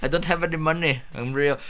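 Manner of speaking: a hint of an Indian accent, repeated through a laugh; lightly voiced Indian accent; faint Indian accent said again; mock Indian accent. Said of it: "I gave him an Indian accent".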